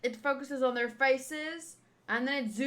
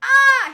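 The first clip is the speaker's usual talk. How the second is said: high, loud shocked cry; startled scream; 10 semitones higher, 14 dB louder than usual. Real, not acted. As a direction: yelp in alarm.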